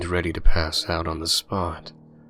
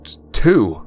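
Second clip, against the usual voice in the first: loud, higher stutter; shaky stammer; 6 semitones higher, 9 dB louder than usual. A narrator's reading. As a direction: stammer it out scared. Kevin stammers again in fear.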